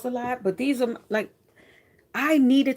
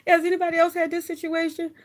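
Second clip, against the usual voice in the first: asking the group; 7 semitones higher than usual. Retold or imitated. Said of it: "I'd be like".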